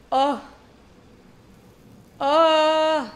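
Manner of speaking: loud, high envious moan; high, loud wail of longing; swooning "oh". Real, not acted. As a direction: let out a drawn-out swoon. Swoons over thick Scottish accent.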